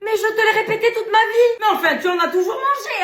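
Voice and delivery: insist, fed up, almost laughing; exaspéré. Acted. Child exasperated arguing. (exaspéré, plus fort et plus aigu)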